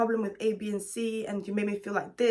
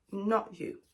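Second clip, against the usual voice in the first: normal talking voice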